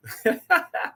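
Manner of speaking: very high, short cackle; real laugh leaping up in pitch. Real, not acted. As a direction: crack up briefly. Still amused by the chat joke.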